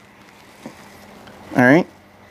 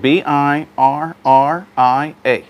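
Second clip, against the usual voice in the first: spelling slowly; slower than usual. Shown as a demonstration.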